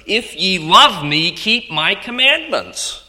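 high, slow, emphatic preacher's declaration; ringing proclamation. Acted. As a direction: let each word ring out, high and drawn out. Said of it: "Let it ring in our ear".

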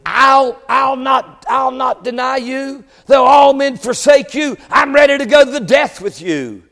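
high, emphatic preacher's quote of a brave boast; bold vow. Acted. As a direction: declare it boldly as a sworn pledge. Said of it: "had said very boldly".

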